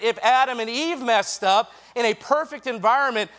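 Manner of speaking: driving, emphatic sermon delivery; preaching. Real, not acted. Preacher urging young people to own their choices, emphatic preaching. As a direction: preach it with punchy key words.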